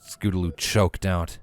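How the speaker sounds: ordinary delivery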